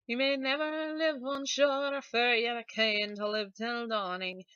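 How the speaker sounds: put-on old grandmother sung in a Scottish accent; an old Scottish granny singing slowly. Singer voicing the selkie's grandmother, Scottish accent. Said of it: "she has to have a Scottish sort of accent".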